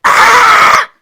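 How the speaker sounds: high, drawn-out shriek of disbelief; outraged scream. Acted. Cheated-on partner exploding at the confession, outraged scream. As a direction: shriek it like you just found out.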